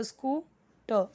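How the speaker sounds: Scooter slowly as "scoo", pause, "ter"; broken into syllables. Shown as a demonstration. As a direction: split scooter for a beginning reader. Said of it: "S C double O". (Scooter को "स्कू... टर" तोड़कर, धीरे)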